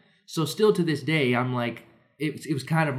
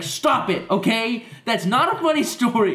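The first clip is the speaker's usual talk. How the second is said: annoyed mom; 8 semitones higher than usual. Retold or imitated. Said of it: "And she's like".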